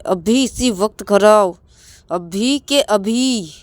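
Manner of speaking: loud, slow and high, every word hammered; angry partner ordering him home over the phone; slow commanding shout. Acted. Ravi orders Rahul home now.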